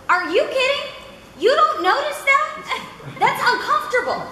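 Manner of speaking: high-pitched incredulous scolding; mock exasperation. Acts out an exasperated director.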